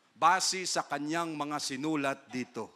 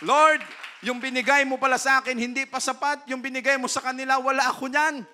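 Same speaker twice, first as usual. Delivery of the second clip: higher, louder envious protest; jealous Solomon; 7 semitones higher, 9 dB louder than usual. Acted. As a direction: complain like a jealous king. Solomon complains in prayer.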